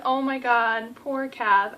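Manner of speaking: normal talking voice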